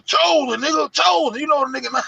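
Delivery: high, loud yell at the screen; screaming at the computer. Retold or imitated. Yelling at his computer screen over a forgotten name, loud and high. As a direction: belt out the name at the monitor. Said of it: "I'm screaming at the goddamn computer".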